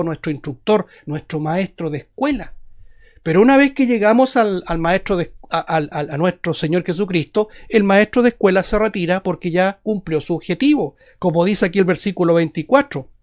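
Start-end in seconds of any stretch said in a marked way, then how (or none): none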